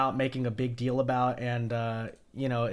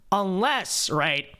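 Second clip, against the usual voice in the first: ordinary talk, nothing marked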